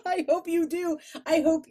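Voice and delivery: high laughing words. Real, not acted. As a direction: laugh through the line.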